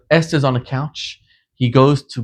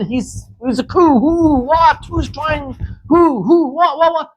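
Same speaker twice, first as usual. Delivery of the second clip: panicked king; 13 semitones higher, 3 dB louder than usual. Retold or imitated. King panicking at news of coup.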